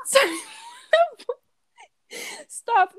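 high giggly protest; laughing too hard to continue. Real, not acted. Giggling fit during podcast intro.

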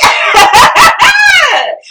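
loud, very high cackle; long high burst of laughing; shrieking laughter. Real, not acted. Co-host cracking up after her random fact, shrieking laugh.

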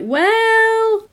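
drawn-out, high-pitched "well"; sing-song, insinuating lead-in; suggestive "well". Retold or imitated. Retells his sly suggestion.

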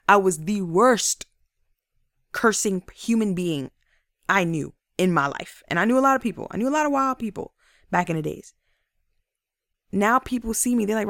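ordinary talk

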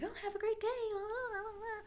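bright sing-song service voice; customer service voice. Retold or imitated. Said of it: "talking to the customer".

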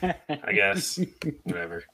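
high I guess, fading to a mumble; timid agreement. Real, not acted. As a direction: shrug it off reluctantly. Grudging reply about the Eagles.

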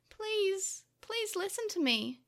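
high, imploring appeal; pleading. A narrator's reading. Tokomon begs angry Takeru for a hearing, pleading. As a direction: beg to be heard. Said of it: "he pleaded, trying again".

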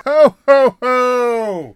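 loud, high, laughing mock ho ho ho; co-host mocks the promo with a loud, high ho ho ho. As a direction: belt out a jeering ho ho ho. Mocking the wrestler's ho ho.

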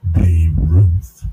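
very loud, low boom; booming shout. Real, not acted. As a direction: blast the name out. Shouted name.

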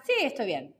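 quick, understated ironic reply; a little ironic. Shown as a demonstration. Podcast teacher contrasting the same phrase, mildly ironic. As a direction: say it offhand, clearly not meaning it. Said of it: "estoy siendo un poquito irónica".

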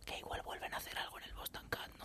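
whispered gossip; conspiratorial unvoiced whisper; secretive whisper. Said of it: "no podemos decir nada".

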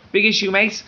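high Scouse street call; mock Liverpool seller calling out; Liverpool accent. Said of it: "he had a Liverpool accent".

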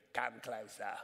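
put-on low, soft British accent voice. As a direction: imitate a talking head with a British accent. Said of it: "it had this British accent".

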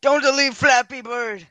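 high pleading whine. Acted. Pleading joke.

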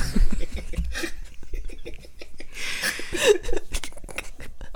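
long rising cackle; high cackling laugh. Real, not acted. Laughs after joke.

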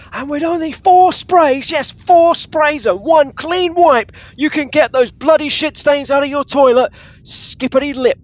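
hammed-up pitchman bursting through radio static; static comms ad. Barry Scott ad hijacking Ash's comms, blaring and crackly. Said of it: "this static cutting in".

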